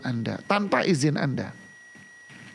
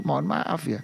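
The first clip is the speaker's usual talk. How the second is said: ordinary talk, nothing marked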